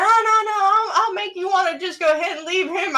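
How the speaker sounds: high, loud mock wail; drunk man's protest. Drunk passenger urging the married driver, high wailing mock protest. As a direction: imitate him crying out his boast.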